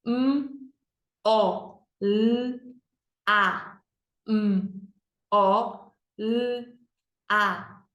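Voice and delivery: M, O, L, A as "mmm, ó, lll, a"; letter sounds. Shown as a demonstration. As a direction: sound out each letter, consonants held. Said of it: "quatro sons". (sonzinho de cada letra: "mmm, ó, lll, a")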